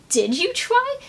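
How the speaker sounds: high, sharp disbelieving question; indignant challenge. Real, not acted. Ranting at Zuko's excuse, indignant high challenge. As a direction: demand it like you caught him out.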